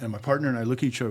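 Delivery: usual speaking voice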